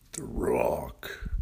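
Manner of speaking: deep, low whispered title. Acted. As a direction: breathe the name low and secret. Podcast intro title, whispered.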